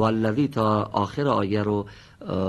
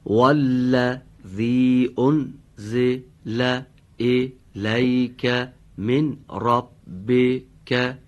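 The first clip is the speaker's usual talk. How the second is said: slow Quran recitation; 3 semitones higher, 7 dB louder, much slower than usual. Shown as a demonstration. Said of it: "قسمت بعدی آیه رو دقت بفرمید".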